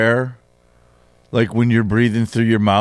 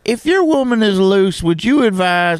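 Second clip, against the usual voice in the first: higher-pitched southern caller voice; southern accent; 6 semitones higher than usual. Acted. Caller from Alaska asks Steve for advice, in a southern accent. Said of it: "I don't know why I have a southern accent".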